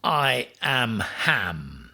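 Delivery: very slow, grand declaration; grand proclamation. A narrator's reading. Ham declares himself to porcupine: slow grand. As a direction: announce it slowly and solemnly.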